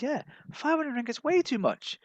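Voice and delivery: high-pitched friend voice; squeaky voiced friend; high local friend voice.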